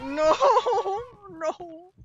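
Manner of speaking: laugh-crying in fright; high panicked laugh; nervous laughter breaking through; scared laughing. Real, not acted. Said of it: "I scream like a girl".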